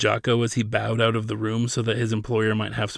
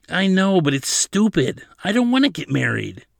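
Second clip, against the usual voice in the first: high, louder objection; petulant protest; 8 semitones higher, 5 dB louder than usual. A narrator's reading. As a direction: push back like a cornered teenager. Petulant argument over forced marriage.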